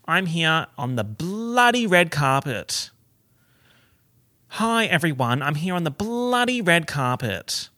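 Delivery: BLOODY stretched long and high; Aussie swearing cadence. Shown as a demonstration. Host on the red carpet accidentally swearing, BLOODY drawn out. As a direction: lean hard on a drawn-out "bloody". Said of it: "be careful not to say "bloody red carpet".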